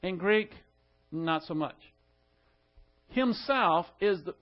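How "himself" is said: Himself said higher with a fall; stress on himself. Shown as a demonstration. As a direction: lift Himself up as the first word. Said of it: "the first word in the sentence".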